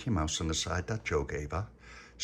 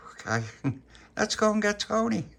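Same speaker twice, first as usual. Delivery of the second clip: high-pitched, put-on eagerness; acted cheery rallying, much higher; forced enthusiasm; 13 semitones higher, 3 dB louder than usual. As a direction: sound keener on demand. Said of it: "Be more enthusiastic, little [name]".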